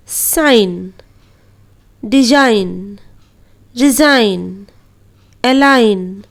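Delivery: sign, design, resign, align with silent g; साइन said as sain, no g sound. Shown as a demonstration. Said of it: "जी का उच्चारण नहीं करेंगे". (जी silent, साइन जैसा उच्चारण)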